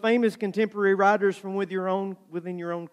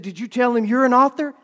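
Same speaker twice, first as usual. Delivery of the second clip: louder, higher imitated question; eager questioning; 8 semitones higher, 6 dB louder than usual. Cindy pressing him about the meeting, mock-eager. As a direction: ask it eagerly, pitched up.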